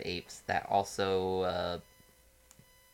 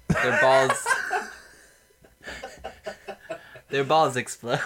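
louder, breaking into laughter; laughing; 3 semitones higher, 6 dB louder than usual. Real, not acted. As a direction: laugh through the punchline. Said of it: "laughing through the punchline".

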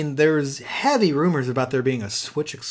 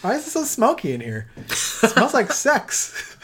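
high silly voice; 12 semitones higher than usual. Pretend. High silly bit, laughing.

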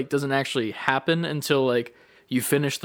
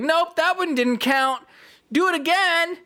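anime voice. Retold or imitated. Game coach rejects a player's rep, high anime voice. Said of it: "like, anime voice".

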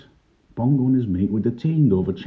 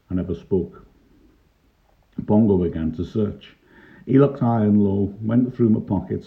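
ordinary delivery, nothing marked

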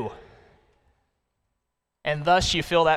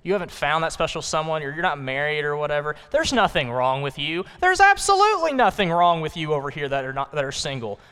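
ordinary delivery

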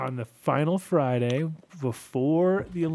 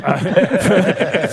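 burst of laughter; 9 semitones higher, 14 dB louder than usual. Real, not acted. Never pushed record, bursts out laughing.

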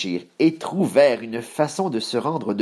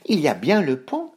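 higher-pitched goat voice; middle billy goat, cautious; 5 semitones higher than usual. Acted. Voices Moyen Bouc in the tale.

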